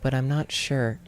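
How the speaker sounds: put-on girl's voice. A narrator's reading. The girl telling the stranger she isn't sure. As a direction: say it like a hesitant girl.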